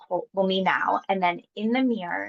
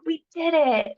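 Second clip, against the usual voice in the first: put-on teen triumphant; 8 semitones higher, 4 dB louder, slower than usual. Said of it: "the teenage Nicole was like".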